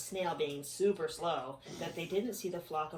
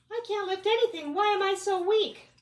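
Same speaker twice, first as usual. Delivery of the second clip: high bug voice; 13 semitones higher, 8 dB louder than usual. Acted. Bug character voice.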